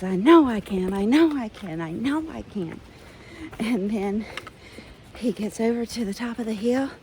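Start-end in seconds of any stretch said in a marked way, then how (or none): 0.0-2.8 louder, confident, gaining momentum; triumphant little engine. Retold or imitated. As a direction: push it out like the engine reaching speed. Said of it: "he goes".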